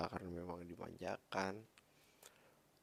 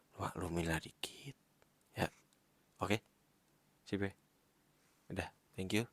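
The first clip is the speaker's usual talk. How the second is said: whispering. Real, not acted. Sleepy sign-off, whispered.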